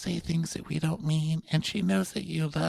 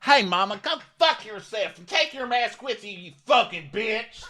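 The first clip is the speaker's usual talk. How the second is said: mock cursing rant; 8 semitones higher than usual. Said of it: "the clip of her going".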